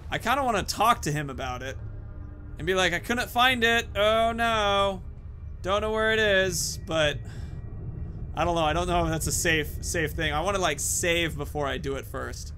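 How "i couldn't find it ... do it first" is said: ordinary talk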